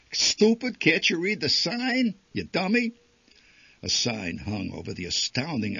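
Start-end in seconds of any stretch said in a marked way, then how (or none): 0.1-2.9 loud, high, scornful scolding; exasperated yell. A narrator's reading. Father yelling at mother at the exhibit, exasperated. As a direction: bark it at her like she's a fool.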